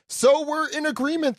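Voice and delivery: high-pitched theatrical declaration; hearty proclamation. Acted. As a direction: declare it high like a stage giant. Reads giant's agreement aloud.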